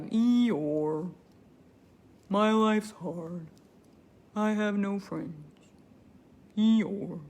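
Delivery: low, slow, soft gloomy donkey; lightly voiced Eeyore, miserable. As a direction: mope like the sad donkey. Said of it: "I started doing this Eeyore voice".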